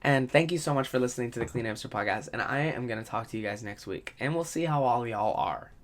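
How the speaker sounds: low, soft, lightly voiced smooth podcaster outro; a hint of a soft-spoken podcast host; mock podcast host sign-off. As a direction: ease into a gentle, hushed sign-off as a parody. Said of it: "I don't talk like that".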